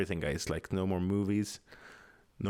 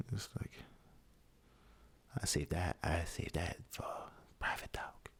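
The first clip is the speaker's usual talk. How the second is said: whisper; 5 semitones lower, 12 dB quieter than usual. Acted. Secretive aside about politics.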